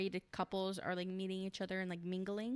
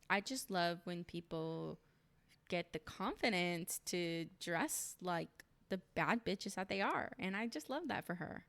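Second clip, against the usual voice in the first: ordinary talk, nothing marked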